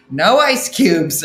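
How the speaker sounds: loud, drawn-out verdict; exasperated declaration. Acted. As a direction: lay it down loudly and slowly. Exasperated verdict on chat advice.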